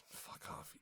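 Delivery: very soft, voiceless dismissal; muttered retort. Acted. Vampire brushing off the hunter's jab, muttered under the breath.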